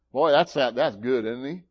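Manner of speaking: ordinary delivery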